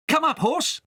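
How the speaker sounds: high eager call; urging the broom. A narrator's reading. Kay urging his broom horse upward, high and eager. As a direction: spur the broom on.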